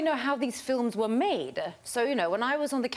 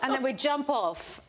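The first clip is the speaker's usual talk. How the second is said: ordinary talk, nothing marked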